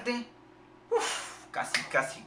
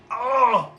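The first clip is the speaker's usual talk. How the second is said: high, loud exclamation; loud amazed "oh"; 7 semitones higher, 12 dB louder than usual. Real, not acted. Player going all out on a shot, amazed shout. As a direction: cry out in amazement.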